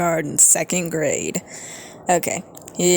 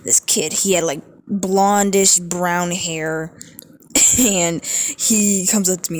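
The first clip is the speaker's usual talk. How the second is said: ordinary talk, nothing marked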